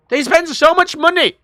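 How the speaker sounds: high, loud exclamation of disbelief; incredulous outburst. Real, not acted. Streamer on an unopened German reactor, loud disbelief. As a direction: exclaim it loudly, pitched right up. Outrage at reactor never opened.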